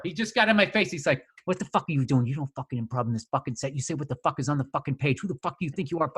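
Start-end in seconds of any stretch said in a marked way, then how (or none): 1.5-6.1 low, rapid, menacing undertone; imitating a producer's hushed, fast tirade; barely above a whisper. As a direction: chew someone out quietly, right in their face. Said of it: "barely above a whisper".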